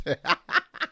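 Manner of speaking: short high burst of laughter. Real, not acted. Reacting to the preacher clip with a high laugh.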